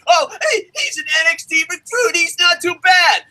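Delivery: high-pitched put-on excuse-maker; mocking fan voice. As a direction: mimic a lenient fan mockingly. Mockingly quoting lenient fans.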